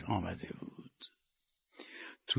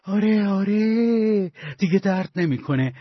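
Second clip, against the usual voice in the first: hopeful self-assurance; 7 semitones higher than usual. A narrator's reading. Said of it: "به پهلوهاش دست کشید و گفت".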